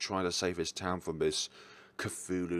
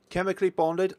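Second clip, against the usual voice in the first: high, bright burst on the game name, louder; excited title announcement; 11 semitones higher, 6 dB louder than usual. Real, not acted.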